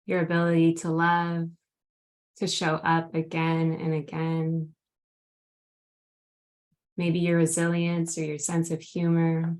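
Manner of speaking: grounding meditation. Acted. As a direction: gently guide them into the present moment. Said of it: "would you lead us in a grounding meditation".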